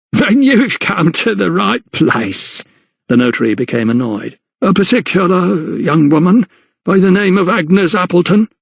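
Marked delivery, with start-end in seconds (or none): 0.1-2.6 chortling landlady. A narrator's reading. 4.6-8.5 a lightly voiced fussy clerk, higher; prim little notary. A narrator's reading. The notary, annoyed, asks the landlady for Agnes.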